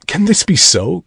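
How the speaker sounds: amazed cry. A narrator's reading. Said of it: "cried Goodman Brown, with a stare of amazement".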